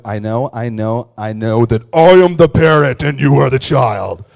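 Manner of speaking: loud, puffed-up big-shot father; mock domineering parent. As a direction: bellow it like an important man who has all the answers. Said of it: "I wanted to".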